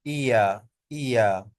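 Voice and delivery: long ईया gliding from ee to uh; diphthong demo. Shown as a demonstration. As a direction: model ईया as one smooth sound. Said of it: "ये जो sound use हुआ है".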